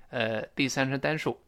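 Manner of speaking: normal talking voice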